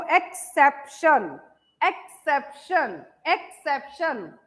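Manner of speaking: "Exception" slowly in three beats, एक - सेप - शन; split into syllables. Shown as a demonstration. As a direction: pause between each syllable of "exception". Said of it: "तो पहले एक". ("Exception" को धीरे से, तीन टुकड़ों में तोड़कर)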